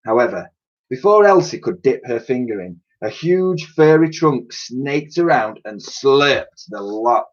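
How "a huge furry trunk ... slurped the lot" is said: usual speaking voice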